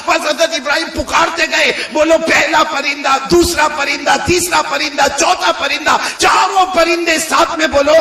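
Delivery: high, driving sermon delivery; impassioned preaching. Acted. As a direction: rouse the congregation to count along. Preacher at full pitch.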